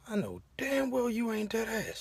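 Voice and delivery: high, soft, drawn-out incredulity; slow squeaky disbelief; acted disbelief. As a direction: draw it out slowly, high and quiet. Stunned muttered disbelief at aunt.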